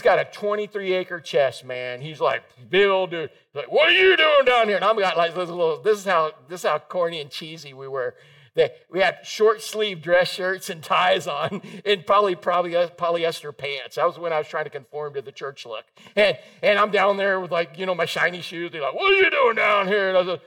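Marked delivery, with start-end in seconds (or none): 3.7-4.8 loud, high-pitched imitated shout; retold confrontational yell; biker's challenge. Big biker challenges young evangelist. 18.9-20.1 imitated biker shout; biker's challenge. Big biker challenge, told a second time.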